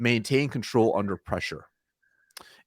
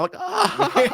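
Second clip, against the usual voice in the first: high, far-pitched nervous "ah" laugh; 3 dB louder than usual. Retold or imitated. Salesman reacting to the owner's insult, imitated nervous laugh. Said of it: "I kinda, like, laugh".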